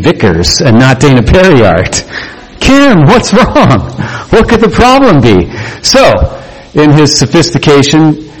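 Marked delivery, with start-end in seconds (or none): 2.6-5.5 mock-innocent child. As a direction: fake concern, sugary and high. Mocking jealous child.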